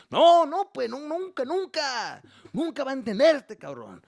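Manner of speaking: very high, loud shouting Mexican dad; exasperated shout. Retold or imitated. Dad shouting to mom about their son, very high and loud. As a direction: yell it out in despair.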